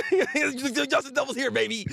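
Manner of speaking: high, giddy laugh through words; cackling with hype; laughing excitedly. Real, not acted. Hyped reveal of favourite character.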